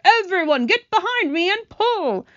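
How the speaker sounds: high, loud rallying call; commanding shout. Acted. Grasshopper organises the rescue haul, urgent shout. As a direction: shout orders to the whole crew. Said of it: "shouted the old green grasshopper".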